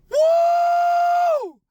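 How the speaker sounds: high, loud whoop of joy; joyful whoop. Real, not acted. Celebrating a year sober on the ride home, big high whoop. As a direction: let out a big celebratory woo.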